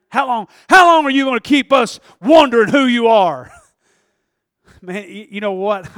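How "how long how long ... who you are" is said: loud, exasperated demand; fed-up crowd yelling; aggravated shout. Acted.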